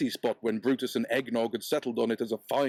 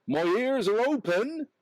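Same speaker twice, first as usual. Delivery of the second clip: higher, hopeful call to the crowd; 10 semitones higher than usual. Acted. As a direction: cry it out to the crowd. Peddler crying out on bridge.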